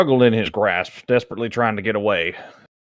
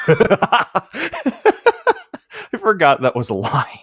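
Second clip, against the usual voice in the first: laughing; 9 semitones higher than usual. Real, not acted. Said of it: "laughing at the line".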